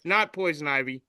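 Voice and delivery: ordinary talk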